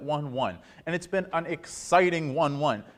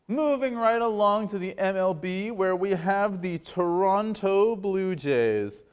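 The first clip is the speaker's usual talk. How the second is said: sports anchor segment intro; 5 semitones higher, slower than usual. Acted. Host introduces hockey segment, slow announcer voice.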